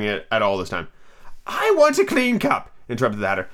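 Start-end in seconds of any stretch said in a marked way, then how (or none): 1.5-3.5 hammed-up eccentric host; demanding Hatter. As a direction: stretch it out high and imperious. Hatter voiced in story.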